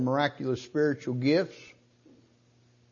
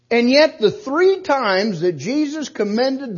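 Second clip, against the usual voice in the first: higher, louder preaching; preacher lifting the congregation to Jesus' words; rising preaching; 8 semitones higher, 7 dB louder than usual. Acted. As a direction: press the point home from the pulpit. Preaching rises.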